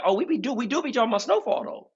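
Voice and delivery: excited babble; fast, high excited. Retold or imitated. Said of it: "I'm like".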